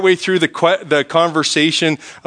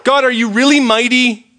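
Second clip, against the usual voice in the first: slow, then higher and exasperated; frustrated prayer; 8 semitones higher, slower than usual. Retold or imitated. Preacher voicing a frustrated believer's prayer, exasperated and slow. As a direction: let the doubt spill out at God.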